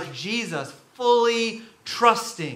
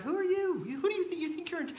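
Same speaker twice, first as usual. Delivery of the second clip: high, softer, scoffing questions; mock incredulous challenge; 12 semitones higher, 7 dB quieter, faster than usual. Onlooker to someone pretending they're in charge, mock incredulous. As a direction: question their authority in a high, light voice.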